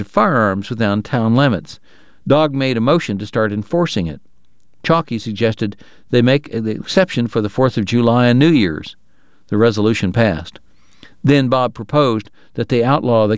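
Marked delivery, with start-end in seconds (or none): none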